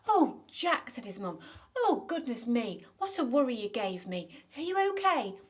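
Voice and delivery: put-on a mother fussing over her boy, loud and high; worried mum. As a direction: gush over him with relief.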